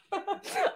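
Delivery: short high burst of laughter; high giggle. Real, not acted. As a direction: laugh at your own bad luck. Bullied by default, cracks up.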